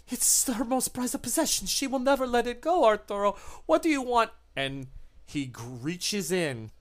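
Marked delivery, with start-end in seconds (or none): 0.1-4.3 much higher, quick and pleading; high anxious creature. Acted. The creature warns Arturo, high and anxious. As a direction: fret aloud to her master.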